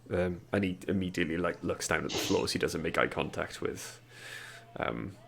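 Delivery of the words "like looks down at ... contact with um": usual speaking voice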